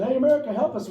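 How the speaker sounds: high, loud acted plea; hammed-up shout for help; mock call for help. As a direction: call out for help as a whole nation. Voices France calling to America.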